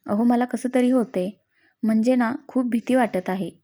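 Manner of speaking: usual speaking voice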